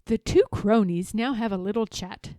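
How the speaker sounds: usual speaking voice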